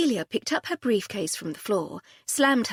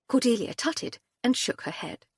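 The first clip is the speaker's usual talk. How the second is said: ordinary delivery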